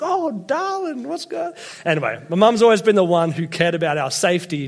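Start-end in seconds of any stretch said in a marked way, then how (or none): 0.0-1.5 high, slow, fretting mother voice; mock anxious mum, pitched way up; fussing mum. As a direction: coo it like a worried mum over her boy. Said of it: "was like".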